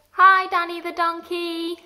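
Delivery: put-on loud, high greeting for kids to copy; sing-song hello for children to repeat; cheery model greeting. Shown as a demonstration. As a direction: call out hello to the donkey, bright and loud. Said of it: "Can you say".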